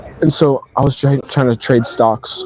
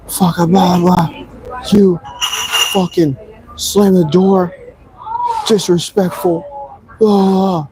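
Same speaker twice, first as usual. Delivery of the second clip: higher, slowed mock rant of an angry girlfriend; hammed-up imitation of her yelling, higher; imitating an angry partner, raised pitch; mock pissed rant; 6 semitones higher, much slower than usual. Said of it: "she's pissed".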